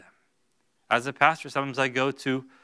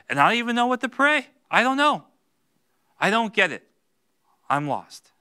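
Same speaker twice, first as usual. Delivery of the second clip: higher, louder, anguished confession; desperate prayer; 9 semitones higher, 6 dB louder than usual. Retold or imitated. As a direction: cry out lost and helpless. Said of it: "It's okay to tell God".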